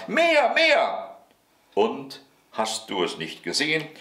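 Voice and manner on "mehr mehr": put-on high, piercing little boy's yell; shrieked demand. A narrator's reading. Häwelmann demands more rolling, high shriek. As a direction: squeal it out like a greedy child. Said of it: "schrie der Häwelmann".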